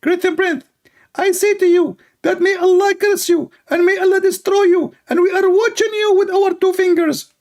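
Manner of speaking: high mocking preacher voice; squeaky sermon mimicking a preacher; Ali Dawa. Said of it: "Ali Dawa".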